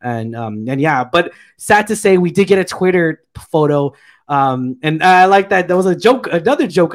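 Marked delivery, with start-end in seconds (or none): none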